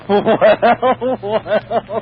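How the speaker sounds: chuckling through a teasing "well, well"; amused, teasing laugh over the words. Acted. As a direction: laugh it out, mocking him. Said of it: "Will you please stop saying that?".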